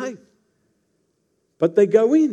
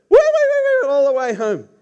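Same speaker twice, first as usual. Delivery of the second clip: high squealing woo, much higher and louder; squealing piggy; 8 semitones higher, 6 dB louder than usual. Retold or imitated. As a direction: squeal like the little piggy running home. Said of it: "and I would go".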